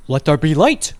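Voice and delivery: loud, mock-divine announcement; grand proclamation. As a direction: declare it like a god. Grand proclamation flipping switch.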